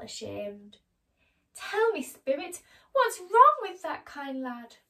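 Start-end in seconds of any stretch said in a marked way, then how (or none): 1.5-4.8 high, loud pleading Scrooge; Scrooge asking. Acted. Scrooge begging the Spirit about Tiny Tim, high and loud. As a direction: beg the spirit for an answer. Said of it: "asked Scrooge".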